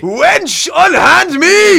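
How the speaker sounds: high, loud, slow theatrical shriek; hammy stage actor. Pretend. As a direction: cry out like an outraged maiden. Mock medieval damsel.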